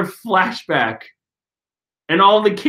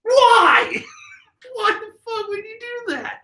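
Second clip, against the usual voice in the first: very high, yelled through laughter; laughing shout. Real, not acted. Yelling at the bullies in disbelief, laughing. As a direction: scream the question while cracking up.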